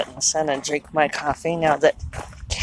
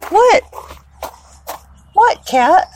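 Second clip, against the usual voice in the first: high surprised call; 13 semitones higher, 4 dB louder, slower than usual. Real, not acted. Cat whining at window.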